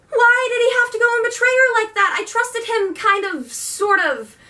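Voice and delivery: real dismay at a character; upset outburst. Real, not acted. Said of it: "It was a really emotional moment for me".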